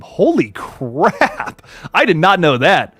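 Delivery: long, drawn-out exclamation of amazement; amazed. Real, not acted. Streamer learns horror trailer is trending number one, amazed. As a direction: stretch it right out in disbelief.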